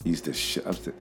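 low, soft imitation of a friend; a hint of HBK, low and hushed; imitating HBK. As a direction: drop the voice low and quiet to mimic HBK. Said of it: "let me see if I can do my H B K imitation".

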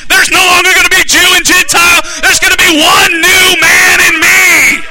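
very loud, high shouted prophecy; prophetic declaration. Acted. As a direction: thunder it out phrase by phrase, building to a long final cry. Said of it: "He spoke prophetically".

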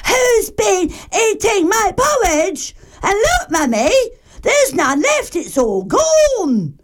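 high, squeaky little bear voice; crying Baby Bear. A narrator's reading. Baby Bear shows Mummy his empty bowl, high-pitched wail. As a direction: wail it like a tiny child. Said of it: "weeny bowl and cried".

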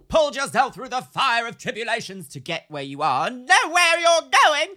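high, loud, theatrical proclamation building to a shout; mock-grand declaiming. Telling the co-host about earned success, high, booming melodrama.

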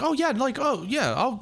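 cheery parking-lot enforcer, high and friendly; cart cop impression. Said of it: "He's like".

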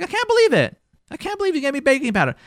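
high-pitched, exasperated complaint; upset spouse. Acted. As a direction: act out indignant disbelief at a spouse. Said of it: "you're so upset at him".

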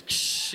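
loud hissed sliding-door effect; door whoosh noise. Retold or imitated. Storyteller voicing the door opening, hissed sound effect. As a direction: imitate a train door shooting open.

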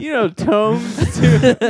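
slow, high Tome Stone, Tome rhyming with home; sounding out words. Shown as a demonstration. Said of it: "forgot how to read the word tomb".

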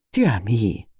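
a lightly voiced puzzled old lady; elderly lady voice. Miss Marple puzzled at phone.

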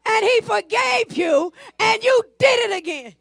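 high-energy sermon delivery; full-force preaching. Real, not acted. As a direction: hammer it home to the congregation.

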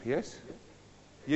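ordinary talk

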